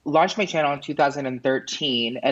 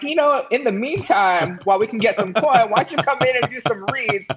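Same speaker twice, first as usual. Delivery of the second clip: high, quick mock-friendly pitch; put-on, pitched-up casual invitation; imitated studio executives; 9 semitones higher than usual. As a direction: breezily imitate an executive making an offer. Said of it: "They were like".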